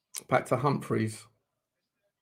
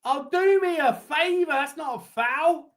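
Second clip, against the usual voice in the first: exasperated disbelief; 13 semitones higher than usual. Real, not acted. Disbelief at foul call.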